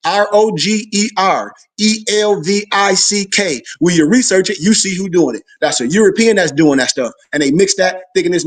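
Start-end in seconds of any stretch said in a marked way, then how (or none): 0.1-3.6 slow letter-by-letter spelling. Shown as a demonstration. Spelling the name for listeners to write down, slow. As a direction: spell each letter slowly and evenly. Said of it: "Write it down".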